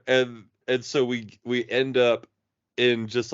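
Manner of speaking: ordinary talk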